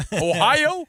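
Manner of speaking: string the scammer along with fake enthusiasm; mock eager scam-call victim. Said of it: "they'll call and they go".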